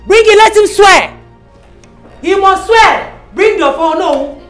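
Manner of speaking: shrill mother insisting on an oath; furious demand. Acted. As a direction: keep hammering him to swear.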